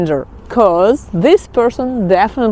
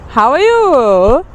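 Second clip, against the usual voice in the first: How are you? drawn out slowly, rising on you; slow, careful How are you? with a rising end; slow, clear model phrase; 4 semitones higher, slower than usual. Shown as a demonstration. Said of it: "how to say how are you?".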